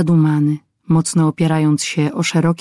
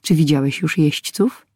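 ordinary delivery

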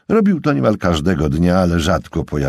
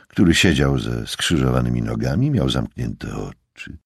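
usual speaking voice

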